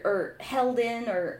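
usual speaking voice

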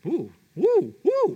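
taste, then squeal with delight; imitating Mikey tasting the cereal. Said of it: "Mikey kinda".

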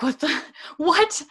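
high, laughing what-the outburst; shocked disbelief. Retold or imitated. Reacting to the book's violent scene, high laughing shock. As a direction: gasp it out in stunned, laughing disbelief. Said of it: "I was just like".

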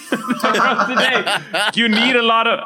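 a slow Scottish try that melts into laughter; Scottish duck voice, laughing through it; giggling half-Scottish read; mock Scottish accent. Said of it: "Say mustard in Scottish".

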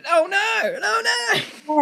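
very high, loud mock-horrified no no no. A passerby hearing about the stabbing, squealing in mock horror. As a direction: squeal in hammed-up alarm.

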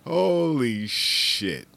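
slow, drawn-out astonishment; amazed. Real, not acted. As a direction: stretch it out in disbelief. Amazed at own discovery.

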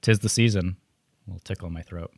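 usual speaking voice

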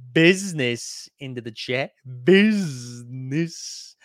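very slow stretched Business; drawn-out catchphrase. Acted. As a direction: drag the word out like a catchphrase.

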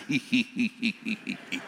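low, soft mock Russian giggle; evenly spaced "hee-hee" imitating an accented laugh; accented laugh imitation. Preacher imitating his Russian nurse laughing, mock accented laugh. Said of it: "Even her laugh had an accent".

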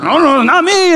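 high-pitched mock protest; mock denial. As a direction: deny it in a put-on high voice. Accused man denies it.

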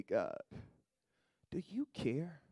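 ordinary talk

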